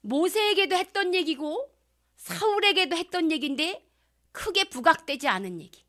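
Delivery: ordinary talk, nothing marked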